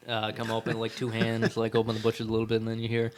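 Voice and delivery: usual speaking voice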